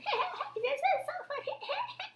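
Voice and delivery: high, soft laughter far off; laughter drifting from the woods; distant laughter. A narrator's reading. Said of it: "heard some laughter in the distance".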